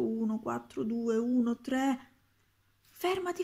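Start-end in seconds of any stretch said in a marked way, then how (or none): 2.9-3.4 high, urgent stop; boy begging the wizard to stop, urgent; urgent plea. Acted. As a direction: cut him off, pleading. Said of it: "disse subito Roberto".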